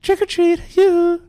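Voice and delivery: put-on high, pinched kid voice; squeaky [name]-like trick or treat. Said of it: "I sounded like [name]".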